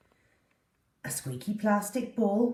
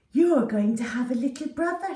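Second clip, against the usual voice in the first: higher and louder, delighted reveal; excited girl; 7 semitones higher, 6 dB louder, faster than usual. Acted. Susie telling Poppy the news, excited and bright. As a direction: share the big surprise with glee.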